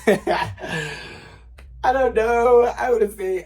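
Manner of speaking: high, loud laughing words. Real, not acted. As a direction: laugh through it, embarrassed. Laughing at the question.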